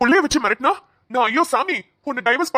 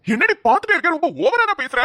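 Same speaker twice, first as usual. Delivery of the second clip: angry outburst; 7 semitones higher than usual. Acted. Husband erupts at wife.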